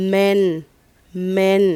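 "men" said loud and clear, twice; "men" held level, as an isolated word; model pronunciation. Shown as a demonstration. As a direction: model "men" for pupils, pausing between takes. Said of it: "จะอ่านว่า".

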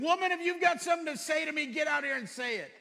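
loud, high, fast angry yell; hammed-up irritated shout from the fridge; retold angry shout. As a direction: bark it out at your wife. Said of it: "I said".

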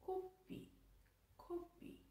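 soft whispered coffee, said as kopi; whispering. Shown as a demonstration. Repeating coffee for viewers, whispered. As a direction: breathe kopi out softly twice.

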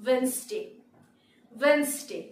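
"Wednesday" as WENZ-day; silent d. Shown as a demonstration. Teacher presenting the next word, "Wednesday" as WENZ-day. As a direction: say "Wednesday" in two syllables, no d. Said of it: "ডি এর উচ্চারণ হবে না".

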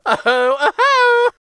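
high loud mocking laugh. A narrator's reading. Gingerbread boy laughing at the horse, high mocking laugh. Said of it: "laughed out loud".

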